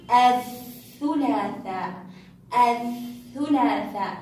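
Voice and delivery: very slow الثلاثاء, no L in the article; silent lam. Shown as a demonstration. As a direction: draw out الثلاثاء as "ath-thulaathaa". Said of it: "لم يخرج صوت اللام".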